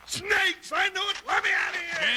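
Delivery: frightened shouts, voice jumping up; panicked yelling. Acted. Jingles sees snakes on the tunnel wall, panicked yelling. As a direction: yelp it out in terror at the snakes.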